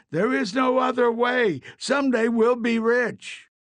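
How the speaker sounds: light mock of people echoing; parroting the slogan. A narrator's reading. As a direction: repeat it like a believer.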